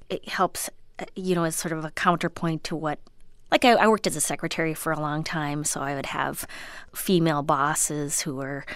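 usual speaking voice

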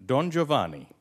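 hammed-up Italian pronunciation; Italian accent. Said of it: "Your Italian accent is phenomenal".

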